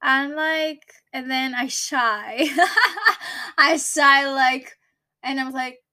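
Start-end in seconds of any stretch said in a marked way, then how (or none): 2.5-3.5 high, bashful giggle; shy laugh. Real, not acted. Laughing shyly after the old lady asks her age.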